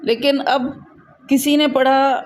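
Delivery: ordinary delivery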